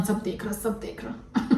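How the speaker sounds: whispered mocking echo of सब देख रहा; sneering whispered repeat. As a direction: breathe it out sarcastically. Repeats her line mockingly.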